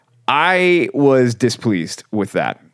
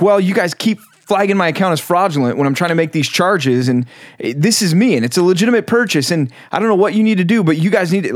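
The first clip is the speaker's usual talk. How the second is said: higher, insistent customer complaint; short with agent; 7 semitones higher than usual. Retold or imitated. Caller protesting flagged charges to bank agent, short and insistent. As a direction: retell it as an exasperated, high-pitched protest. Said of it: "I was a little short with the guy".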